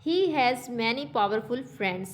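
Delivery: a slow, careful reading of the example sentence; slow textbook reading. Shown as a demonstration. Teacher reads the example sentence to students, slow and careful. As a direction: read it out word by word for the class.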